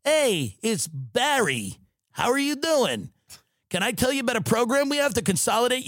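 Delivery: call-center telemarketer. Acted. Rep cold-calling an elderly mark, sing-out phone sales greeting. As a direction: hail a stranger on a cold call, high and upbeat. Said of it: "with your head on the phone going".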